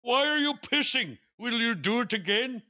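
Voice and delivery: high, scolding mock Indian accent. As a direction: play the man scolding the mouse over the rice. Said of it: "the guy's like".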